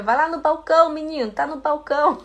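put-on high-pitched, lively call to a kid; talking to a child. Imitates herself talking to a child.

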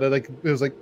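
ordinary delivery

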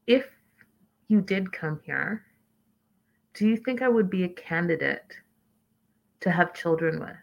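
softened voice with long, careful pauses; deliberate and creepy. Retold or imitated. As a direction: imitate a creepily measured fiancé. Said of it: "this is how he talks to her".

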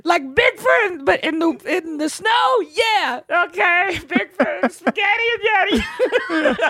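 squeaky, very high mock weeping; crying rapper, mock. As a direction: blubber high, dissolving into laughter. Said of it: "crying, rapping, too".

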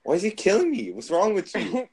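high, loud protest; yelling complaint. Real, not acted. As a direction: yell at a teammate who killed you. Complaint at teammate killing him.

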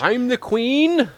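slow, high mock queen boast; wrestling promo. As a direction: drawl it like a smug champion. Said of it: "cutting a promo".

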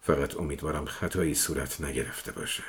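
low, soft grumble; grumbling. A narrator's reading. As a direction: grumble it under your breath. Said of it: "قری زدم".

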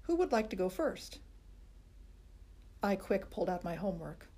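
plain reading voice

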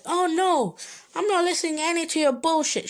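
high, fast mock of a dismissive teacher. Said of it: "the teacher was like".

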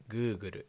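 グーグル; Japanese pronunciation. Shown as a demonstration. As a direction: say "Google" in katakana style. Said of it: "In Japanese".

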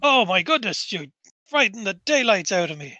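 startled cry easing into flustered relief. Acted. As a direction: jump in fright, then gasp it out. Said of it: "gives a startled jump".

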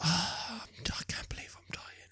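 faint breathy whisper of a dying man; hushed deathbed whisper to wife. Retold or imitated. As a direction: whisper it like your last breath. Dying man's words in dream.

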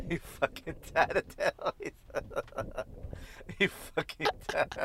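repeats it through giggles; half laughing the line. Real, not acted. Friend repeating the insult while laughing.

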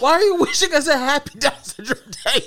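high, loud question through helpless laughter; laughing incredulously. Real, not acted. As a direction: squeal it out, cracking up in disbelief. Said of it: "you were laughing way too hard".

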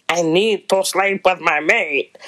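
mock Scottish accent that slips toward a vampire; a lightly put-on Scottish accent for a brooding dragon man; attempted Scottish accent that comes out a little vampiric. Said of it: "I don't know if that sounded like a little vampire, but they're Scottish".

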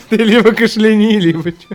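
words through laughter; laughing. Real, not acted. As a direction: say it while cracking up. Laughing at co-host's throat.

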